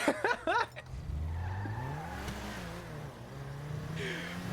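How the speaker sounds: long quiet delighted laugh; long giddy laugh. Real, not acted. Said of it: "A K A Calodro's Coke Connect".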